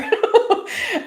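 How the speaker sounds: high burst of laughter; real laugh. Real, not acted. Speaker laughing at being such an Olympic crier, real high laugh.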